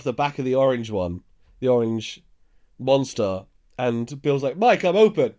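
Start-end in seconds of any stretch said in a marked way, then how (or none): 4.6-5.3 higher, louder mock call to a teammate. Bill calling to Mike on court, imitated shout. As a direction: imitate a player shouting for the pass.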